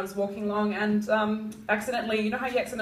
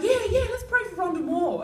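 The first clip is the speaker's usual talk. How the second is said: high, eager agreeing; eager follower; 11 semitones higher than usual. Retold or imitated. The other preacher agreeing eagerly, high. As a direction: chime in keenly. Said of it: "the other guy's like".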